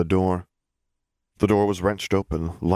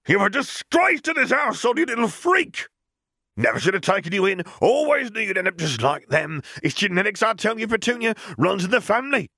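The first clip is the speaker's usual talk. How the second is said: raging rant. Acted. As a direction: scream abuse at the little girl. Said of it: "continued to rage".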